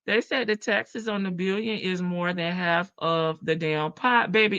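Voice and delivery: ordinary talk, nothing marked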